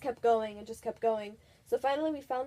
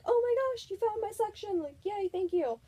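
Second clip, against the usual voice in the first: much higher, thrilled squeal; excited; 10 semitones higher, 4 dB louder than usual. Retold or imitated. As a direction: gush like a delighted fan. Finding favourite band section.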